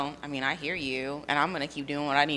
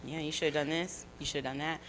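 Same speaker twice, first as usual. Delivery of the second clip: soft, quick-to-dismiss aside, acted; judging inner thought; 2 semitones lower, 8 dB quieter, faster than usual. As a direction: voice an unspoken judgement, softly.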